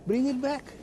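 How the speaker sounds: high, playful mock invitation; joking reassurance. Retailer jokingly telling customers to return the sherry, high and playful. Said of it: "jokingly perhaps".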